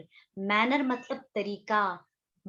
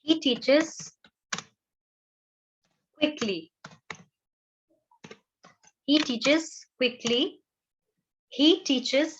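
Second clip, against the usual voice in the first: drawn out word by word as she writes; slow dictation; 4 semitones higher, much slower than usual. Shown as a demonstration. Teacher to students while writing on board, slow dictation. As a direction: say it at writing speed, very slowly. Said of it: "teaches की spellings कर देती हूँ".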